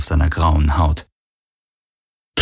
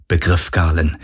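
ordinary delivery, nothing marked

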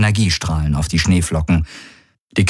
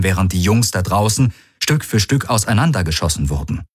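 usual speaking voice

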